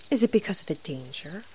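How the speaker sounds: ordinary delivery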